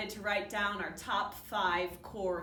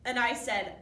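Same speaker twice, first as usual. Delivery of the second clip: normal talking voice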